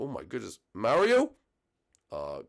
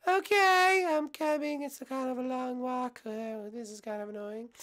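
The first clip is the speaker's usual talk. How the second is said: high squeaky princess voice; Peach puppet voice; 4 dB louder than usual. Acted. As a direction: play a high-pitched cartoon princess.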